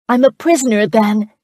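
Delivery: loud and high for the whole crowd. A narrator's reading. Charlotte wants the crowd to see a tyrant, loud and high. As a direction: raise your voice so everyone hears. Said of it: "raising her voice so all could hear".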